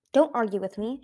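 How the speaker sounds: usual speaking voice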